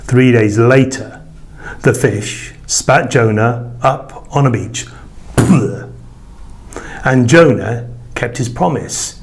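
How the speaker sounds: plain reading voice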